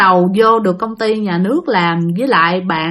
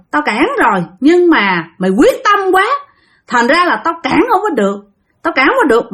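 fierce spirit scolding; 6 semitones higher than usual. Retold or imitated. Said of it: "cái kiểu của ổng rất là dữ".

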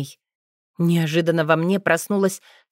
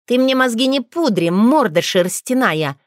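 louder, higher cutting snap; sharp retort; 5 semitones higher, 4 dB louder than usual. Acted. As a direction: cut him off harshly. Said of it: "отрезала ведьма".

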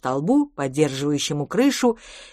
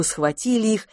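plain reading voice

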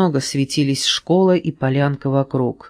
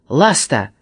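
louder warning call; shout; 4 dB louder, slower than usual. A narrator's reading. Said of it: "крикнул Флеш".

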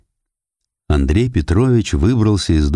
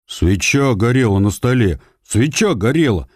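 firm; 5 semitones higher than usual. Acted. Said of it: "твёрдо произнёс мальчик".

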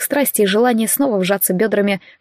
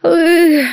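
cry of release; 8 semitones higher, 4 dB louder than usual. A narrator's reading. Climax cry.

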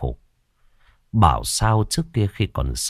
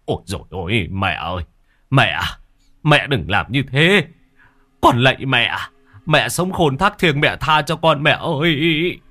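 wailing plea; 5 semitones higher than usual. A narrator's reading. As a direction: cry out and beg, rising higher. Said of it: "lại có tiếng người kêu gào".

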